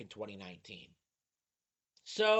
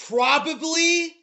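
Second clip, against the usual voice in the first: slow, much louder and higher disbelieving echo; incredulous; 13 semitones higher, 16 dB louder, slower than usual. Real, not acted. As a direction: repeat the word in stunned disbelief. Commentator shocked at the reply.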